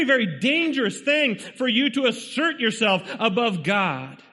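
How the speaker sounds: high-pitched, driving sermon delivery; urgent pulpit exhortation; impassioned preaching. Real, not acted. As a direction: preach it hard, pressing each point on the congregation. Preaches at full force.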